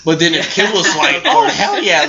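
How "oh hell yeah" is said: eager agreement. Retold or imitated. Imagines Kim eagerly agreeing.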